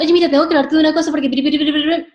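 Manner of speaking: breathless chatterbox salesperson, much too fast; rushed rambling. Shown as a demonstration. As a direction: gabble the pitch far too quickly. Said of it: "tampoco la velocidad nos acompaña".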